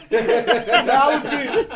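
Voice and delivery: loud burst of real laughter, words breaking through it; cracking up mid-sentence, loud and slow; laughing out loud. Real, not acted. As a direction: laugh hard at your own story. Laughing at his own story.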